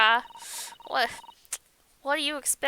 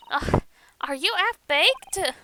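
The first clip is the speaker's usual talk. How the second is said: higher, slow, disbelieving ugh; incredulous scoff; 6 semitones higher, 4 dB louder, slower than usual. Acted. Gina scoffing at the lawyer's offer, slow disbelief. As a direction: scoff at the idea, drawn out.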